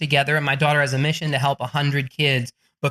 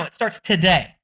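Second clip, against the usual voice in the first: ordinary talk, nothing marked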